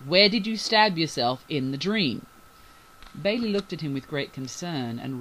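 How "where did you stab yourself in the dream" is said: louder, insistent questioning; urgent. A narrator's reading. Bryce presses Bailey about her dream wound, urgent. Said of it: "he continued urgently".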